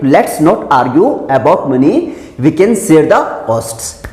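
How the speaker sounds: slow, careful example sentence; slow model reading. Shown as a demonstration. Teacher reads the example slowly. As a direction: read it out slowly. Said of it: "share कर लेंगे".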